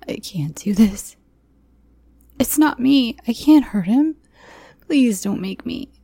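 high, urgent begging; desperate pleading. A narrator's reading. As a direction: plead frantically to be spared the act. Acted desperate plea to captor.

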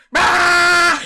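high, loud hammed-up roar; mock monster roar. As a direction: roar like a mad driver. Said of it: "driving the car like".

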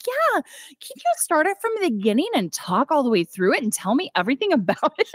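high, eager fake-naive pleading; playing dumb. Barbie asking Ken to explain The Godfather, high fake-naive gush. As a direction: gush it like a wide-eyed girl asking. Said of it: "appear that you don't know anything".

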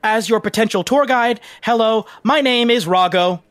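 high, enthusiastic guide voice; eager tour guide. Acted. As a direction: greet visitors in a high, over-eager pitch. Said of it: "he turns and is like".

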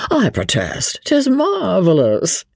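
drawn-out foppish marvelling; imperturbable. A narrator's reading. Sir Percy marvels at the Vicomte's English, imperturbable. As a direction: stretch it slowly, quite unruffled. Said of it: "continued Sir Percy imperturbably".

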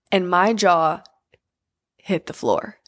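normal talking voice

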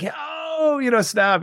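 high mock shout of delight; classmates' whoop. Classmates hooting at the teacher after the comeback, a high mock shout. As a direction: cheer like a teasing classroom crowd.

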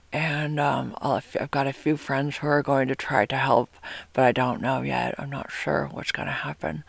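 soft, subdued imitated tone; imitating her date's voice. Sick date talks of friends trying to help, imitated subdued voice. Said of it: "this is the tone".

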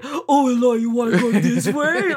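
lightly voiced dopey caricature; mock oaf voice, ending in laughter; Eloy. Sister mimics mom's family voice for brother, lightly oafish. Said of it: "he was known as that".

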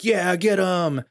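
high, drawn-out mock cheer; egging on. Another person watching the bar fight, cheering it on. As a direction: play a rowdy onlooker urging them on. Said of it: "And another person's like".

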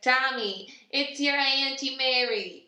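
loud, high New York auntie voice; calling out to Tommy in a brash New York accent. Acted. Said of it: "one that we try to do for New York".

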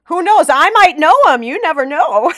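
loud joking tease; playful tease. Real, not acted. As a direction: tease her, voice bright and up. Host teasing, laughing.

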